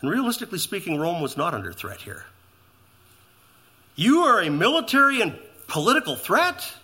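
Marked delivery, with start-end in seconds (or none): 3.9-6.7 high, scornful question; mocking disbelief. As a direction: treat the charge as a joke. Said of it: "It's ridiculous".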